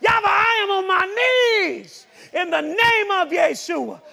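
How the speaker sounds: high, loud holler, easing slightly; urgent shouted prayer; desperate calling out. Shown as a demonstration. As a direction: grab God's attention with a long shouted cry. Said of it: "you gotta say".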